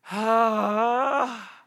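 short mock groan of a bored child; imitated groan. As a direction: groan like a tired toddler.

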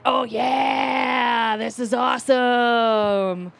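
long, stretched-out gushing cheer; drawn-out excitement. Retold or imitated. Naive guest invited to stay at the bar, gushing slowly. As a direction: draw every word out, dreamily thrilled. Retelling her naive enthusiasm after hours.

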